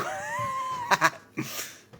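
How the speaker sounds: loud squealing burst of laughter; shrill cackle at the top of the voice; high shrieking laugh. Real, not acted. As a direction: let out a piercing laugh. Laughing burst after quote.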